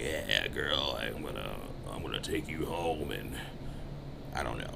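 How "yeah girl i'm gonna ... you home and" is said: lightly voiced sleazy guy, low and quiet; a hint of a creep's come-on; sleazy low pickup line. Mocking a guy who goes too far, quiet sleaze. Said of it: "You can be like".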